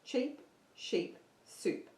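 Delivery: slow, soft, spelling out the words; slow demo of the twister. Shown as a demonstration. Teacher slowly models the twister for the class, soft and careful. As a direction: say each word slowly and softly. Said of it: "just say this fast".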